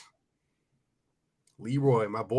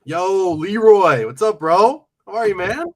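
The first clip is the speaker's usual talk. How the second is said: loud greeting; 7 semitones higher, 9 dB louder than usual. Real, not acted. Greeting a viewer by name.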